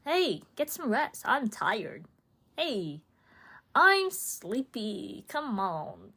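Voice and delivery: lightly voiced, sing-song nagging body; a playful, childish plea to go to bed; cutesy body voice. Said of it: "my body doesn't say it like that".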